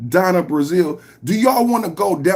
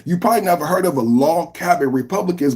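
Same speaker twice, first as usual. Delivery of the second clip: ordinary delivery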